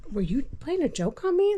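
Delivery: can't believe he'd pull this; shocked disbelief. Retold or imitated. Wife to husband who seemed dead, stunned and high. Retelling her shocked reaction.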